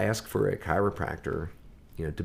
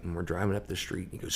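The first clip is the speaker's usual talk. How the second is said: normal talking voice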